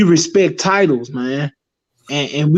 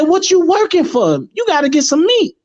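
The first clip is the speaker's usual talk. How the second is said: higher put-on foreign voice; imitating the guy; 9 semitones higher than usual. Higher imitation of the guy telling him to buy meat. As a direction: mimic the guy lightly, pitched up. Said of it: "He said".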